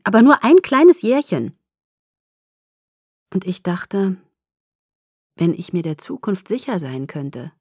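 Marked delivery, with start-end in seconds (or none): 0.0-1.6 high, loud, perky downplaying; chirpy, emphatic reassurance; cheery belittling. A narrator's reading. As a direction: make it sound tiny and harmless. Friend brightly playing down age gap.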